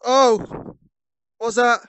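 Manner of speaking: sudden loud alarm; startled. Real, not acted. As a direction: jump at the noise.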